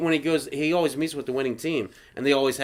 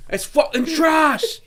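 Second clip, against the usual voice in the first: high, loud mock rant; hammed-up angry shouting, drawing out the insults; imitated chef's tirade; 11 semitones higher, 6 dB louder than usual. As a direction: berate the cooks like a furious chef. Imitating chef berating the cooks.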